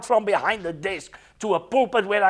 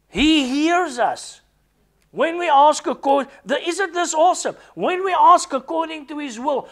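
raised, high-pitched preacher's voice; fervent preaching; 6 semitones higher than usual. Real, not acted. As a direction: proclaim it with rising conviction. Preaches with fervour.

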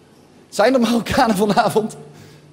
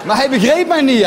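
ordinary delivery

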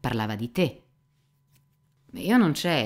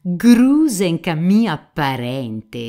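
loud, slow, drawn-out indignant cry; indignant outburst; 4 semitones higher, 9 dB louder, much slower than usual. A narrator's reading. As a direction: explode at the insult, stretching each word. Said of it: "esclamò di scatto Rakitin, arrossendo tutto".

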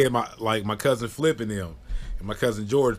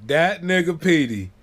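usual speaking voice

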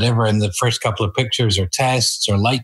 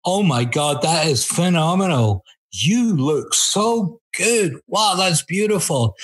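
mock gushing praise; 6 semitones higher, slower than usual. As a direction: gush over it like an overjoyed photographer. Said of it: "at the top of my voice go".

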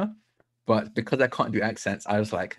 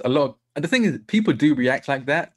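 ordinary talk

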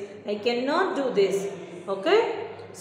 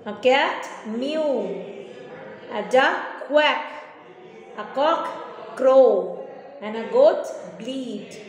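slow dictation; slower than usual. Shown as a demonstration. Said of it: "teacher drills animal sounds slowly".

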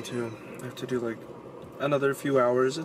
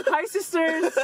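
high, loud "Hi, sisters" greeting; imitating James Charles; 12 semitones higher, 4 dB louder, slower than usual. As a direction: do a hammed-up beauty vlogger hello. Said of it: "James Charles Who".

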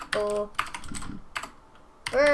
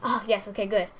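ordinary talk

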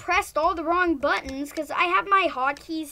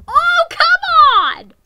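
loud, high, drawn-out groan of protest; frustrated shout; 12 semitones higher, 16 dB louder, slower than usual. Real, not acted. Gamer shouting at the screen in frustration. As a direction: yell it at the unfair game. Frustrated at being detective again.

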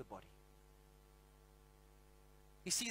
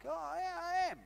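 smug delight; 8 semitones higher than usual. Retold or imitated. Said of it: "I'm like".

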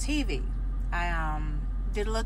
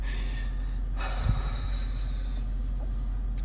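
acted long, breathy exhale; out-of-breath puffing. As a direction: mimic being winded between takes. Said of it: "tell me why I was out of breath".

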